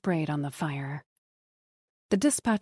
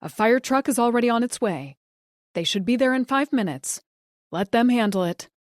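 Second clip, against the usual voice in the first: higher, louder, firm operator voice; dispatcher; 5 semitones higher, 5 dB louder than usual. A narrator's reading. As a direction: reassure the caller firmly. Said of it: "said the operator".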